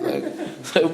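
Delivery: usual speaking voice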